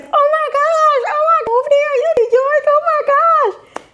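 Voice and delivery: very high, slow, gushing "oh my gosh"; mock excited squeal. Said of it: "They were so excited".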